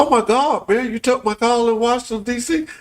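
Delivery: high, thrilled exclamation; giddy, high, amazed; excited retelling. Retold or imitated. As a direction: gush like you can't believe it. Excited retelling of mayor call.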